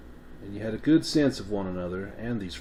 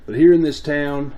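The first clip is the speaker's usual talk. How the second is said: louder, higher Appalachian accent; 7 semitones higher, 12 dB louder than usual. Shown as a demonstration. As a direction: lean into the Appalachian twang. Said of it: "I'm gonna do my best Appalachian accent".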